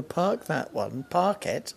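normal talking voice

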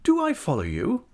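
high, polite inquiry rising at the end; affable, businesslike. A narrator's reading. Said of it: "with her most affable air of business".

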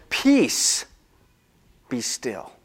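soft calm command fading to a hush. Retold or imitated. Jesus speaking to the wind and waves, soft and calm. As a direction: still the storm gently.

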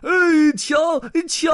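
very high goldfish shriek; piercing little-fish cry; alarmed shout. Acted. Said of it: "鲸鱼咕噜咕噜地吐着泡泡喊道".

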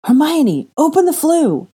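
high loud urgent call; urgent cry. Acted. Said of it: "Ginny's voice cried through the room".

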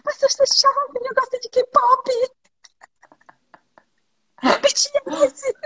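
high squeaky mock young guy; mimicking a young guy. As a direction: squeak it out like a teen fan.

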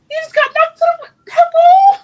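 high, loud exasperated yell at the screen; incredulous shout. Retold or imitated. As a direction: shout it like you can't believe he's ignoring the fight. Host retells reacting to the fight.

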